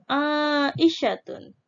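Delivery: aisyatun said slowly, final tun clear; slow dictation. Shown as a demonstration. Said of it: "ada tun juga di belakangnya".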